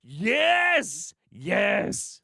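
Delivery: high, laughing whoops of yes; elated cheering. Real, not acted. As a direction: cheer it loud and high, delighted. Cheering a played clip.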